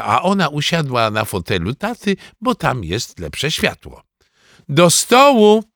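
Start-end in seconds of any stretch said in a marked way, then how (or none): 4.7-5.6 loud, high mealtime call; mother's raised, high-pitched summons. A narrator's reading. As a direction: call the whole family to the table. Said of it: "zawołała mama".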